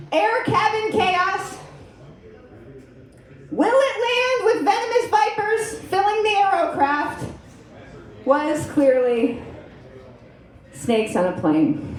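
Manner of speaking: slow dramatic trailer announcer; movie trailer voice. Pretend. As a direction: build it like a blockbuster trailer.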